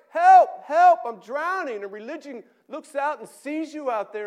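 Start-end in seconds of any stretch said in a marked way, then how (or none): 0.1-1.8 frantic high scream for help; desperate drowning cry; shout. Acted. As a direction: yell as if going under. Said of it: "hears your cries".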